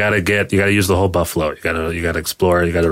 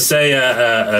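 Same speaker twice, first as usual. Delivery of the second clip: normal talking voice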